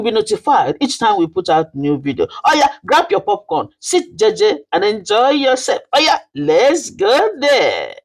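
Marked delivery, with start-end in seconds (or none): 2.4-7.9 much higher, fast and bubbly hype call; excited hype. Acted. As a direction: fire up the audience before the story. Channel intro hype before story.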